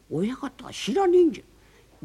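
hushed aside to the others; slow conspiratorial whisper. Acted. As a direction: keep it secret, whisper it. Boatman whispering to his mates.

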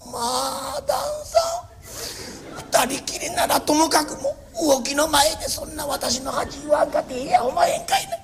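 high wail breaking into crying; tearful protest. Acted. Wife tearfully protesting her husband's insult. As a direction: whimper it out, hurt and teary.

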